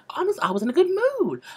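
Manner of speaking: high, fast bubbly reply; the girl answers, pitched high and quick; perky girl voice. Retold or imitated. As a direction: squeak it out like a cheery young girl.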